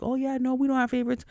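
high, sing-song mock innocence; mocking fake denial. Mocking coworkers who deny having favorites, high fake-sweet. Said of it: "people act like".

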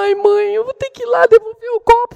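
put-on high-pitched whining child; imitating a dismayed little girl's protest; childish discontent. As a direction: whine reluctantly to mom. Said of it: "veio a hora do descontentamento".

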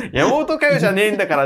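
retold punchline. Retelling a joke aimed at one listener, loud and high. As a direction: throw the quip out emphatically.

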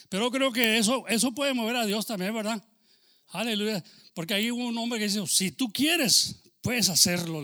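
loud, high fervent preaching; impassioned sermon peak; full-force preaching. Real, not acted.

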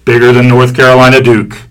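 loud hype announcer voice. Acted. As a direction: build up the big battle. Hyping up a rivalry promo.

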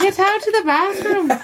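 very high mock scolding; Kate. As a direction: order him out in a squeaky imitation. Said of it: "she has such a high voice".

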